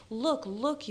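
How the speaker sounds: understated excited shout; mild yell. A narrator's reading. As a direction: call out brightly, lightly voiced. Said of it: "you yelled".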